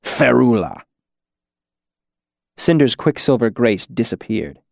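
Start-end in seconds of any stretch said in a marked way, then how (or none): 0.0-0.8 sudden hard, cold command; hard as steel. A narrator's reading. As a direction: utter the word like a steel rod. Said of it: "soft voice went as hard as a rod".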